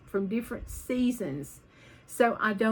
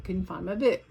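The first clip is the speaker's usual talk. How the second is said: normal talking voice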